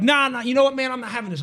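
high, agitated refusal at the start; acted-out alarmed protest; retold freak-out. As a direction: re-enact backing out in a mild panic. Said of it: "I start freaking out".

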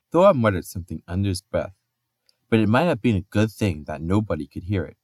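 ordinary delivery

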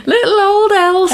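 very high, put-on little voice; playful squeaky-high affectionate teasing; cutesy voice. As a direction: coo the name in a silly high pitch. Playing with the name in silly voice.

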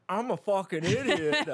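high, exaggerated mock voice of a friend; mocking impression. Teasing the co-host with an impression of them, mocking. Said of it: "here's my impression of you".

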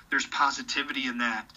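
normal talking voice

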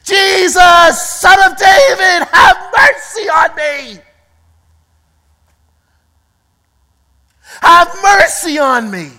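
loud, high desperate plea to Jesus; desperate cry. Acted. Blind man crying out to Jesus for mercy, desperate shouting. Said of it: "He cried out".

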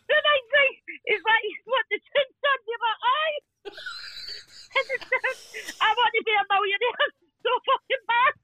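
high, breaking up with laughter; real helpless laughter while telling; laughing. Real, not acted. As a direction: push on through the giggles. Cracking up at own story.

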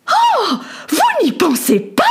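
high, slow, shocked noblewoman; a lady outraged at the idea; scandalised lady. A narrator's reading. Lady refuses to take off her skirts, high and scandalised. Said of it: "d'un air scandalisé".

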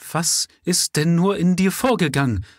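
loud, high, would-be stern demand; lame attempt at command. A narrator's reading. Mother trying to sound commanding to her son. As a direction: try to sound commanding and fail. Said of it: "mit einem lahmen Versuch, gebieterisch aufzutreten".

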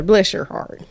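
playful Southern "Bless your heart" with a laugh; light Southern belle tease; laughing Southern accent. Pretend. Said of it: "I have a nice Southern accent".

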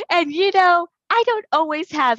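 high, loud, laughing through it. Real, not acted. Laughing while telling.

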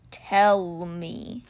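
low, slow, hushed creature voice; whispering cheetah. A narrator's reading. Stone cheetah asks the boy for the truth, hushed. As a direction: draw it out low, as a grieving big cat. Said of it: "he whispered".

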